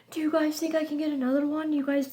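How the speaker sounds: high-pitched imitation of herself asking; retold eager asking. Fan asking other fans for help, high and eager.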